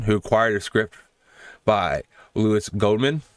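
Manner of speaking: loud, low, measured announcer read; presenter's outro. Acted. Host closes the saga and credits the makers, loud measured read. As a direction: deliver it as a formal film-history read. Switches to formal scripted outro.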